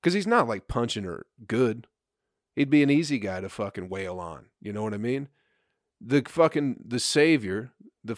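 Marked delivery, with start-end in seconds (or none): none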